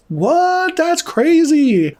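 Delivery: very high, drawn-out mock surprise; feigned disbelief. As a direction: play innocent with slow, high-pitched words. Voicing the caught man feigning innocence.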